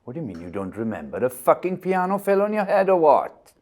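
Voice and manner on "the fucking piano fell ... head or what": loud, much higher disbelieving shout; incredulous yell. Acted. Victor yelling at a confused patient, incredulous. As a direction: blurt it out in disbelief.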